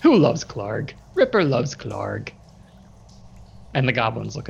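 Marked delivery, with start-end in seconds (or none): 0.0-2.3 bugbear cooing to his wolf in a put-on voice; baby talk to wolf. A narrator's reading. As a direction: talk to the wolf like a pet, doting. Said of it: "he talks to his wolf".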